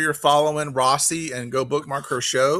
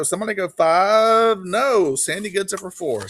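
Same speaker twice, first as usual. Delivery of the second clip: urging bid call; 6 semitones higher than usual. Real, not acted. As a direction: egg the bidders on, pitched up. Auctioneer urging a higher bid.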